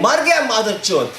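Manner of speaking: mild mock yell with a rising swoop. Poet quoting the screaming horde, mock yell. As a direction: imitate a yelling horde without full volume. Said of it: "to the screaming horde yelling".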